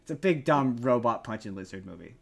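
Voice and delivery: ordinary talk, nothing marked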